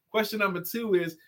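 ordinary delivery

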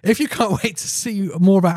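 laughing through the words; giggling while speaking. Real, not acted.

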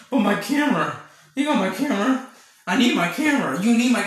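mock frantic pleading. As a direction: plead urgently, hammed-up. Acts out TMZ guy pleading for camera.

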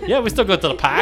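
put-on laughing Boston accent; "park" as "pahk", dropped R. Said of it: "Bostonian accent".

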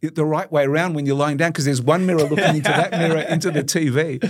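high, fast, laughing impression of his thrilled dad; delighted dad. Dad raving about the mirror TV view: high laughing delight. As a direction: gush like a kid who found the best room ever.

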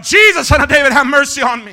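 urgent cry from the crowd. Acted. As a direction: shout the plea for mercy. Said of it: "he cried out".

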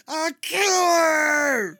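high, slow, loud movie-trailer yell; dramatic shout. Acted. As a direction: belt it like a horror trailer. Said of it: "I like how you did that both times".

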